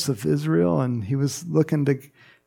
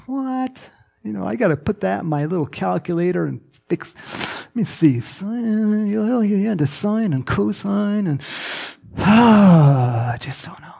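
higher, fussy voice working a sum; mock overthinking doubter; 6 semitones higher than usual. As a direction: fuss over it, then sigh and give up.

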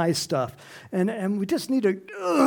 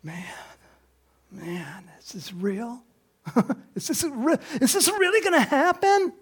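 Martha daring to hope; nervous disbelief; 10 semitones higher than usual. Retold or imitated. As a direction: Martha whispering doubts, then begging. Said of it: "And Martha's going".

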